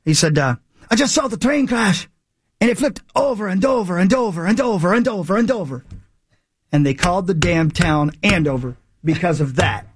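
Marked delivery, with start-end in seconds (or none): 0.9-5.8 a lightly voiced, higher Irish accent for the witness. Retelling the Andover train legend in a light Irish accent. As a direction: play the excited Irish witness, pitched up. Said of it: "I'm gonna do Irish accent 'cause I don't know what accent he had".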